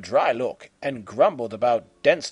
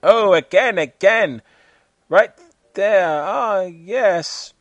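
high, hammed-up moaning; mock moaning; 7 semitones higher, 6 dB louder, slower than usual. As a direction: ham up the suggestive moans. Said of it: "loud groans and moans were heard".

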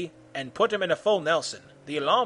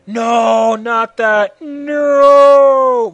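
loud, high pleading scream; scream, ending on a long high wail; 7 semitones higher, 12 dB louder, much slower than usual. A narrator's reading. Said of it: "screamed Freddy".

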